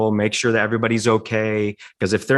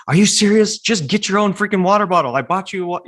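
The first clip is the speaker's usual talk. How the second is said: snapping at daughter; 10 semitones higher than usual. Retold or imitated. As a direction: blow up over the stolen water bottle. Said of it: "and I lose my mind".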